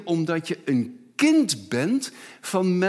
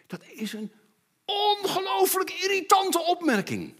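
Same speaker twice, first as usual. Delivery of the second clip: hoog verontwaardigd uitgeroepen; geïrriteerd; 11 semitones higher than usual. Retold or imitated. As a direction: klink als een geërgerd kind. Kind geïrriteerd nagedaan. (geïrriteerd kind, hoog uitgeroepen)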